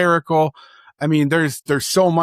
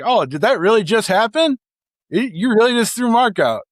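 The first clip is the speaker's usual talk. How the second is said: higher, amused feigned disbelief; mock disbelief; 8 semitones higher than usual. Manager feigning shock at the umpire, higher mock disbelief. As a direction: play dumb, acting shocked.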